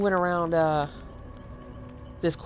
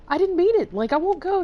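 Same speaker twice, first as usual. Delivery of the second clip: much higher, louder desperate begging; a kid begging not to be punished; panicked pleading; 6 dB louder than usual. Retold or imitated. Teen pleading with mom over the sick dog, panicked.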